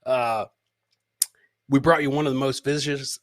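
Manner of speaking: usual speaking voice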